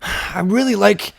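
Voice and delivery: high, loud, drawn-out mock sincerity; intense compliment. Weird stranger praising someone's hair, menacingly intense. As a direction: praise that sounds like picking a fight. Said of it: "he was so intense".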